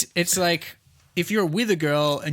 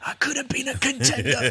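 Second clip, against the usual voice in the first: movie quote voice; 10 semitones higher than usual. Acted. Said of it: "movie quote".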